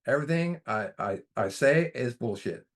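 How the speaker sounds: put-on an old Southern grandpa's voice that rises sharply on son, then slows down; grandpa's Southern accent. Grandpa lecturing his grandson in an old Southern accent. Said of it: "he would say in his old Southern accent".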